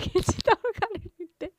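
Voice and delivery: laughing out loud mid-sentence; cracking up while telling it. Real, not acted. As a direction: giggle through the words. Giggling over midnight snack.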